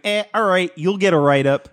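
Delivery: Chicago teacher imitation. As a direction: imitate the old teacher handing out a write-up. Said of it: "I remember him".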